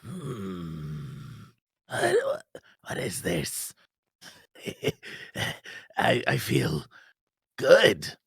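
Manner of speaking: very low, quiet rumbling monster voice; deep groggy lizard. Acted.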